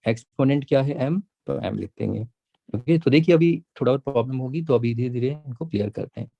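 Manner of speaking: ordinary talk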